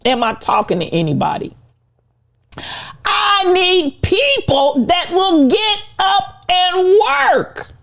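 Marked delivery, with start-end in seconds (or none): 3.1-7.7 slow, high, pressed preaching shout; high, slow preacher's cry; preaching at full force. Acted. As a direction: drive it home from the pulpit. Preaching climax.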